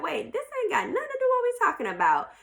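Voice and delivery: high, rushed, taken-aback objection; baffled protest. Acted. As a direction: act thrown by an out-of-the-blue attack. Acted confused protest at verbal attack.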